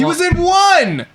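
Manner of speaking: high, loud and slow, thrilled; excited shout. Real, not acted. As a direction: burst out with glee. Excited outburst about Stallone.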